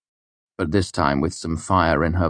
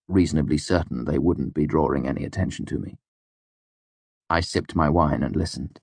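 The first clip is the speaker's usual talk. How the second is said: plain reading voice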